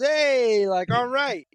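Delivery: high, loud cool-guy catchphrase; pointing-at-you catchphrase impression; Arthur Fonzarelli. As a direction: imitate a sitcom greaser's approving call. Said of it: "like a Arthur Fonzarelli kinda".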